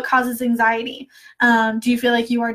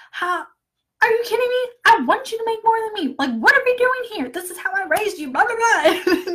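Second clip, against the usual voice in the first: mom's reply; 9 semitones higher than usual. Retold or imitated. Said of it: "And she was like".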